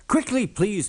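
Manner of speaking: high hurrying call. A narrator's reading. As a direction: hurry the passengers along. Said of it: "called Thomas".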